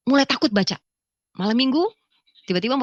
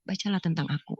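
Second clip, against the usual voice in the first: low, soft answer; retold divine voice; 5 semitones lower, 8 dB quieter than usual. God answering the confused woman, low and soft. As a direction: drop low and quiet as the unseen voice. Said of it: "Tuhan cuma bilang".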